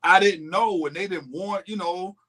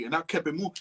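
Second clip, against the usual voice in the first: ordinary delivery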